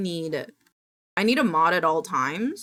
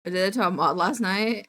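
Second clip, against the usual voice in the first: ordinary talk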